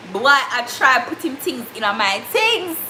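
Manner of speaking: ordinary talk, nothing marked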